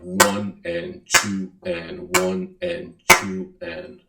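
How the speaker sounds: slow counted beats, one and two and; demonstrating duple counting. Shown as a demonstration. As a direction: count it out evenly. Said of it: "so you'd have".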